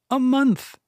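a short, high, firm "A month"; Finta's answer. Acted. Finta answers, voluptuary repeats.

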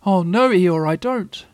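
high, small squeaky voice; a nervous little pig piping up; timid Piglet. A narrator's reading. Answering Eeyore, a high timid Piglet.

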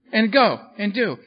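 ordinary talk

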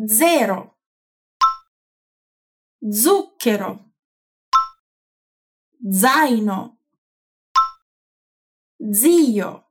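voiced dz at the start of "Zero" and "Zucchero"; Italian pronunciation. Shown as a demonstration. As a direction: say "Zaino" and "Zio" slowly with initial dz. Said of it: "Ripeti dopo di me".

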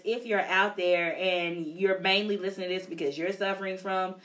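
ordinary delivery

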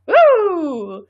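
high, drawn-out cheer; celebratory whoop. Pretend. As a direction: let out a long whoop for the prize. Cheering the joke prize.

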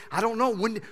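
ordinary talk, nothing marked

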